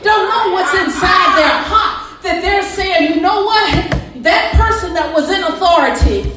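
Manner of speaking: loud, high preaching build; fiery preaching. Acted. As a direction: preach it with rising fire. Preaching at full force.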